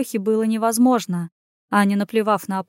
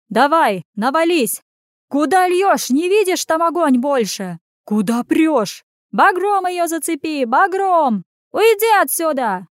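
higher, louder frantic shouts; shouting townsfolk; 7 semitones higher, 4 dB louder than usual. A narrator's reading. Townsfolk shout to each other at the fire, shouting. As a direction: yell orders over a fire. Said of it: "Горожане кричали".

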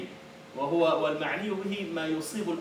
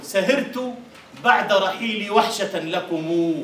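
slow proud declaiming; poem recital; 3 semitones higher, 6 dB louder, slower than usual. Acted. Teacher reciting Mutanabbi to the class, slow and proud. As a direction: recite it with dignity.

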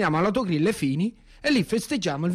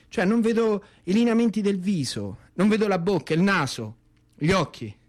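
normal talking voice

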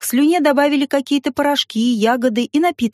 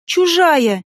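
desperate cry; 5 semitones higher than usual. Acted. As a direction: fling it out in despair. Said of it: "воскликнула в отчаянии".

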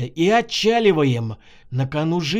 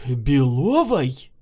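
slow, drawn-out stunned question; taken aback, drawing the name out; stunned exhale; 3 semitones lower, much slower than usual. A narrator's reading. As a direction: breathe the name out in disbelief. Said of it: "резко выдохнул и остыл Максим".

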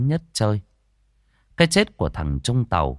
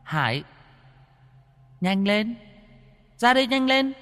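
calls rising much higher in pitch; urgent calling; 12 semitones higher than usual. Acted. Friends waving Hải over to get out, urgent calling. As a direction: call a friend over, hurrying more with each call. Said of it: "đang đứng vẫy gọi".

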